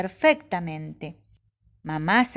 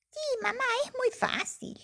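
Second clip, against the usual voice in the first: put-on much higher, small child-like voice; little Pepe voice; 7 dB quieter than usual. Young possum Pepe answers his mother, high and eager.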